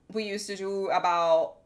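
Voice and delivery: ordinary delivery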